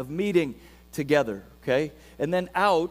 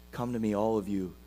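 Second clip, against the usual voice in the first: hushed scripture reading; 6 semitones lower, 10 dB quieter than usual. Acted. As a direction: lay the words down gently like an invitation. Soft scripture reading, Jesus quoted.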